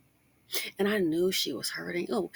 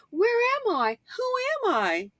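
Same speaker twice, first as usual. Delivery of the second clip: much higher, bewildered quote from a lost woman. Retold or imitated. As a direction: squeak it out like someone who lost herself. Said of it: "they're in a headspace of like".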